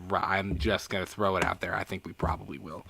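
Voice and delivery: whispered aside. Real, not acted.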